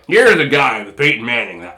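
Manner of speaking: lightly voiced football announcer; Chris Collinsworth impression. Imitating the announcer about Peyton Manning, lightly voiced. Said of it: "he'd be like".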